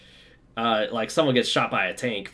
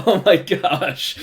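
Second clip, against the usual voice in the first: laughing; 8 semitones higher, 7 dB louder, slower than usual. Real, not acted. Reacts to a your-mom joke.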